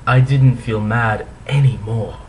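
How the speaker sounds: usual speaking voice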